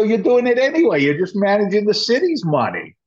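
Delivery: high-pitched acted rebuttal; insistent retort. Developer countering his fire chief buddy, insistent. Arguing back in retold exchange.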